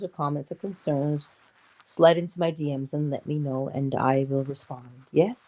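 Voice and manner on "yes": normal talking voice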